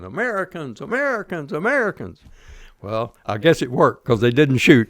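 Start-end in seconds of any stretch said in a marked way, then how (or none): 0.0-2.2 imitated yelling. Veteran re-enacting his call to Marines at the gate, held-back yelling. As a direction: call it out high and drawn, volume held back. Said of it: "started yelling".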